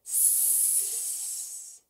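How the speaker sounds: long soft hiss; snake hiss. Shown as a demonstration. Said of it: "Hiss like a snake".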